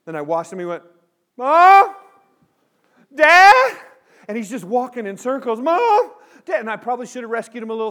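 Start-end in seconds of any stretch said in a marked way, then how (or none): 1.4-3.8 put-on high, loud lost-kid cry; panicked child. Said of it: "that little kid freak out". 5.6-6.6 high, loud call for a parent; panicked child. Retold or imitated. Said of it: "that little kid freak out".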